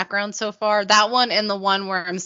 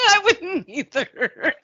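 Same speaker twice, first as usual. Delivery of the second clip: laughing; 9 semitones higher, slower than usual. Real, not acted. Laughing reply.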